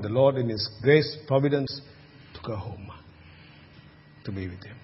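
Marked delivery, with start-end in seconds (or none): 2.3-4.8 hushed. Real, not acted. Mother-in-law's death at her bedside.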